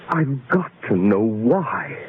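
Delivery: usual speaking voice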